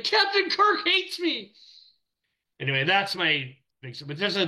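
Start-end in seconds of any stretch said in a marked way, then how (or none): 0.0-1.5 much higher, slow, mock-tragic wail; mock despair. Young animator mourning Kirk's scorn, in mock despair. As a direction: wail it like a hammed-up heartbreak. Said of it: "It's like".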